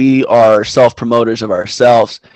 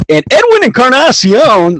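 high, loud broadcaster voice; play-by-play announcer; 12 semitones higher, 4 dB louder than usual. Retold or imitated. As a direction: call it like a TV announcer. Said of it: "the play-by-play guy".

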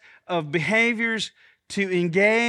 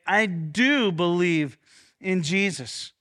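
slow it right down and lean on do; slow stress on do; 2 semitones lower, slower than usual. Shown as a demonstration. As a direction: do drawn out slowly and weighted. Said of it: "No, no, no, wait".

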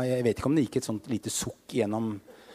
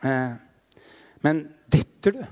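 normal talking voice

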